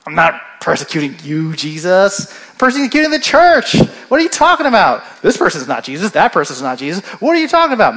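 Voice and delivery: a cheeky know-it-all talking back; smart aleck. Retold or imitated. Saul talks back to Jesus like a smart aleck, sassy. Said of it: "he could be like".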